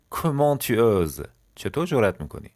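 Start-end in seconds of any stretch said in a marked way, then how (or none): 0.1-1.3 each syllable drawn out slowly for learners; slow model phrase. Shown as a demonstration. French teacher to students, slow model read. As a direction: say it slowly and clearly.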